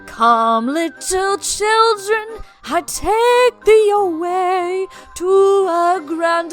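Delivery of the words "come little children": usual speaking voice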